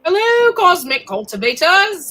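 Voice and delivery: bright, loud, high hello to viewers; excited greeting. Real, not acted. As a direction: greet the chat with a big lift. Greeting viewers on live stream.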